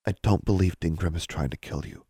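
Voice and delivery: soft, timid reply; small hushed voice; almost inaudible. Acted. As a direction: say it very quietly, barely daring to disagree. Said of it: "Her voice was almost inaudible".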